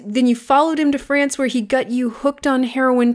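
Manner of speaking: ordinary talk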